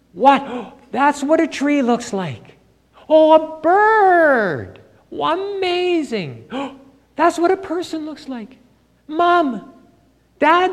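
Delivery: high, breathless wonder at seeing everything, gasps between; amazed blind man. Acted. Healed blind man marvelling at his parents, high awed voice. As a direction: play a man seeing the world for the first time, awestruck and slow.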